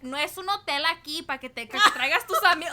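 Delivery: loud, high scolding mom in Spanish; nagging Mexican mom. Retold or imitated. Mom scolding her kid for bringing friends home, loud and high. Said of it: "moms are always like".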